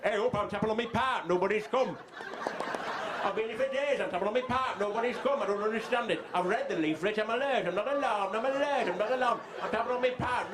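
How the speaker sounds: trapped northern man. Acted. Man buried under debris complains nobody answers his tapping, plaintive. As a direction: whine bewildered from under the rubble.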